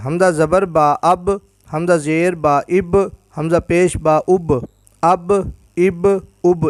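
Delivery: joined syllables اب with a small echoing release on the b; qalqala. Shown as a demonstration. Teacher drills jazm with ba for students, qalqala bounce on each final b. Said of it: "اس کو قلقلہ کر کے پڑھیں گے".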